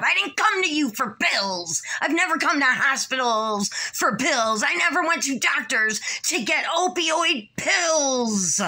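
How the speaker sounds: angry, aggrieved insistence; real anger. Real, not acted. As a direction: protest angrily, pitch riding high. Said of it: "I'm so angry".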